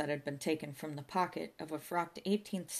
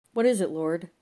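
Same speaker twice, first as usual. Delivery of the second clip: loud, imploring call heavenward; calling to God; 2 semitones higher, 9 dB louder than usual. A narrator's reading. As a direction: call out to the Lord for an answer.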